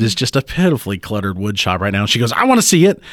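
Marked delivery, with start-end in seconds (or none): 2.3-3.0 put-on little girl. Little girl demanding in garage.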